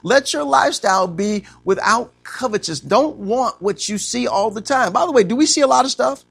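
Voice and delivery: preaching at full force, rising. Acted. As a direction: preach it big and build. Preaching, building up.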